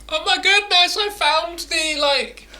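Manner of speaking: high, gushing mock excitement; excited gushing. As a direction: gush like you found a miracle. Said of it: "you'd just be running around like".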